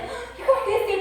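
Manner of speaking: a mother pressing her crying daughter; worried mother. Retold or imitated. Mother's worried question voiced.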